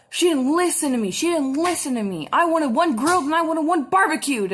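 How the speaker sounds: mock irate customer. Male customer complaining about his order, imitated indignantly. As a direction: imitate an aggrieved man yelling, punching each demand. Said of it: "they're, like, yelling at me".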